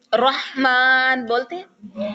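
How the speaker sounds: Rahman drawn out very slowly; slow model reading. Shown as a demonstration. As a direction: stretch Rahman with breathy ح. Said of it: "اس طرح سے". (رحمان کو بہت آہستہ، ح واضح)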